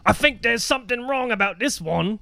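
high-pitched hammed-up British accent; British bartender. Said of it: "pretending to be the bartender".

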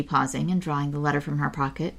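ordinary delivery

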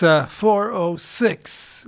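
ordinary talk

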